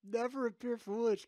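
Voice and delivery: very high, loud, laugh-choked; unable to keep straight face. Real, not acted. Streamer repeating his one rule to chat, cracking up. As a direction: push the words out while cracking up. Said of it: "can't even say it with a straight face".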